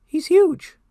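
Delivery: high, loud incredulous burst; astonished objection. Retold or imitated. Dad questioning son's Hulk dream, astonished high outburst. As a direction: blurt it out in high-pitched disbelief.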